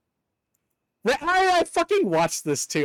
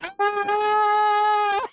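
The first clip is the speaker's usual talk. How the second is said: high held shriek; mock monster scream; 14 semitones higher than usual. Gamer imitating Guzzlord's scream, high held shriek. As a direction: let out a Guzzlord-style scream. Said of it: "He just fucking screams".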